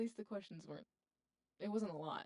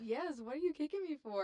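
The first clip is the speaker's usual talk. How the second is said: surprised; 7 semitones higher, 8 dB louder than usual. Real, not acted. Kicked under the table.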